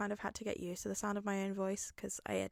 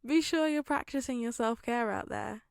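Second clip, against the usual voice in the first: higher, giggling goodbye; laughing sign-off; 6 semitones higher, 4 dB louder than usual. Real, not acted. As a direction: laugh while wishing them well.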